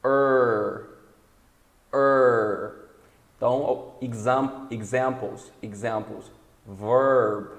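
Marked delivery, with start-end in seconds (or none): 0.0-2.7 "er" held, a bit louder; er sound. Shown as a demonstration. Teacher modelling the er vowel for the class. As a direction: hold the er vowel. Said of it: "vai ser".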